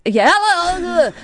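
high, slow swooning hello; mock swoony hello. Echoing her friend's joke, a slow high smitten hello. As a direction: stretch the hello out like a smitten fan.

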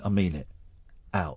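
low, hushed and deadly serious; deep, quiet insistence. Acted. Star guitarist insisting the chatterer leave, low and quiet. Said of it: "He said".

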